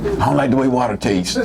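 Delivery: low whiny mock picky drinker; mock complaint. Preacher mocking people who won't drink water, whiny. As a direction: mimic someone snubbing plain water.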